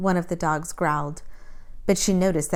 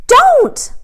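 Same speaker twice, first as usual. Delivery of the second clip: loud, high furious shout; sharp, loud, high protest; angry cry; 14 dB louder than usual. A narrator's reading. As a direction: shout to stop him cold. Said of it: "she said angrily".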